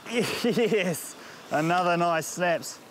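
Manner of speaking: ordinary talk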